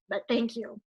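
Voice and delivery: teary, hushed gratitude; fighting back tears. Real, not acted. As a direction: hold back the tears as you thank him. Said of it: "I'm not gonna cry".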